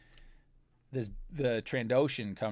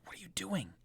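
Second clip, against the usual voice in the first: urgent whisper; 8 semitones higher, 6 dB quieter, faster than usual. Acted. Jonathan whispering to his brother in the standoff, urgent. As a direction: hiss it under your breath. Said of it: "kinda whispers".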